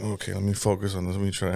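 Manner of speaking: mock whisper to himself. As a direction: mutter it under your breath as if in class. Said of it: "And I'm like".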